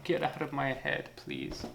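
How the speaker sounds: mock crying spectator. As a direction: play the spectator begging, half-sobbing. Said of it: "they're gonna start to cry at this point".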